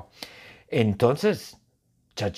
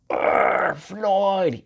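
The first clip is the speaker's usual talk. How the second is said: high growl into a drawn-out shout; exploding angry yell; 8 semitones higher than usual. Acted. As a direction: roar the name in cartoon fury. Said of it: "Ella grita". (grito furioso fingido con gruñido)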